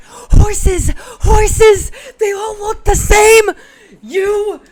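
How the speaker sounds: high, very loud manic yelling; crazed horseman shout. Acted. Crazy horseman ranting at a stranger, manic shouting. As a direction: shout it like a raving madman.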